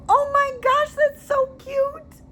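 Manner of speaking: high, slow, squealing delight; gushing girly voice. Pretend. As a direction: gush like a trendy girl buyer. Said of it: "I said it like a girl for a reason".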